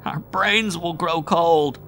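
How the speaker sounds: high, slow, moaning dread. A narrator's reading. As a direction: moan it out in terror. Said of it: "he moaned".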